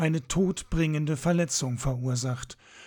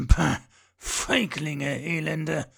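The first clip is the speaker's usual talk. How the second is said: slow, scornful growl; slower than usual. A narrator's reading. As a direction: snarl at the hidden archers. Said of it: "knurrte er". (verächtlich geknurrt)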